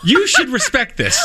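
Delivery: high laughing jab at a friend; laughing tease. Real, not acted. Laughing at co-host who double checks vets.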